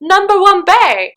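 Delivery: high incredulous fangirl voice; mock a viewer's outraged disbelief; imitating shocked viewer. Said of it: "you're thinking".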